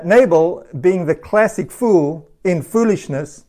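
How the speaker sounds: ordinary delivery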